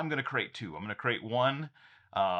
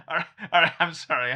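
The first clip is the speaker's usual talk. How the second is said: loud, high, still giddy from laughing; catching his breath after a laugh; 5 semitones higher, 9 dB louder, faster than usual. Real, not acted. Apologising to viewers for having too much fun, giddy. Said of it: "I'm having too much fun with this".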